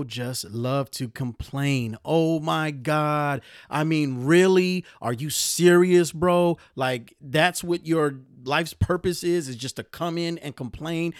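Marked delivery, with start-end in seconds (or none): 2.0-11.1 incredulous, loud and slow; exasperated disbelief at complainers; riled up. Real, not acted. Host ranting about complainers, loud disbelief. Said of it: "I'm getting a little riled up".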